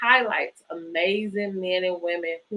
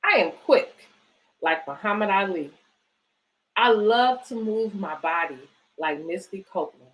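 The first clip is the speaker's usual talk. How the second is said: put-on reading to children. Reads picture book aloud.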